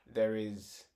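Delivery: normal talking voice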